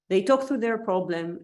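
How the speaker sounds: ordinary talk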